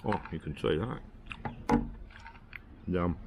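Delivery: normal talking voice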